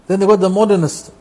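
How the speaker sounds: ordinary delivery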